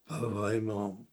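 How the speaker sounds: léger grognement, lent et plus grave; grognement de lion. A narrator's reading. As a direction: grogne la réponse comme un lion boudeur. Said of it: "répond le lion en grognant". (le lion répond au narrateur en grognant doucement)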